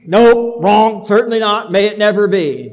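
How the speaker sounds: loud, emphatic, almost laughing refusal; emphatic Paul denial. Retold or imitated. Paul firmly rejecting the false argument, loud and emphatic. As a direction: bark it out, shutting the idea down. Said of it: "And he says again".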